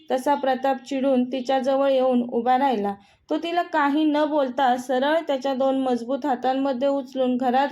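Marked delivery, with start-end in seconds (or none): none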